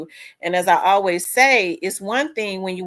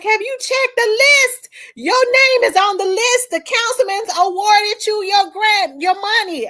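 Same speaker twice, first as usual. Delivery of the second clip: excited friend; 7 dB louder than usual. Retold or imitated. Friend telling her the councilman awarded her grant, high and excited. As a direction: spill the good news, bursting.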